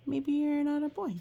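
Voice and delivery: ordinary delivery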